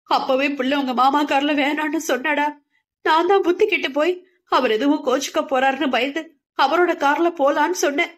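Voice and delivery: breathless high mother; panting as she walks uphill; out of breath. A narrator's reading. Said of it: "மூச்சு வாங்கியவாறு". (மூச்சு வாங்கும் அம்மா)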